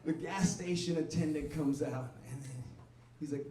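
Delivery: usual speaking voice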